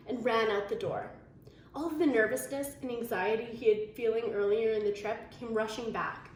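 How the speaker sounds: plain reading voice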